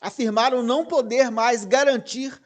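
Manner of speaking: insistent, bold-type emphasis from "não" onward; emphatic stress on NÃO. Real, not acted. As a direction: hammer out "não poder mais garantir". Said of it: "tá em negrito isso".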